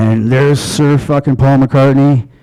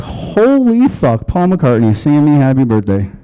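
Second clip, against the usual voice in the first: mock starstruck amazement; 2 semitones higher than usual. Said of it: "most people in this situation would be like".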